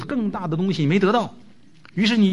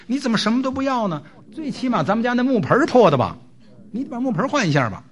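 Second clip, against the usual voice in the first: retold wife's angry tirade, raised pitch; furious wife; 5 semitones higher than usual. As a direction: nag him hard as an outraged wife. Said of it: "太太是勃然大怒啊".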